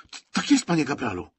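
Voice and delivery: a flustered recruit catching himself; nervous stammer. Acted. Recruit stammers before corporal.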